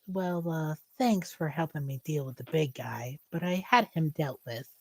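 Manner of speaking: lightly voiced elf, low and soft; quiet, understated thanks with a reservation; mildly curious elf. Elf thanking the flyer who helped with the big guy, mildly curious. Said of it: "curiously as she goes".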